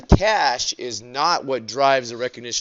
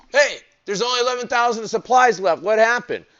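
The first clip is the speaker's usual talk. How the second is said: high, quick, surprised exclamation; mock surprise; 10 semitones higher, faster than usual. Business owner counts the supplies and finds few left, mock surprise.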